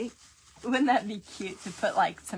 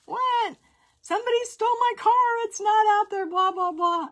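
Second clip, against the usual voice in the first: put-on higher, louder, fast panicked teenage daughter; 13 semitones higher, 7 dB louder than usual. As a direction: mimic a frantic teen whose car is gone, hammed-up. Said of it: "And I'm like".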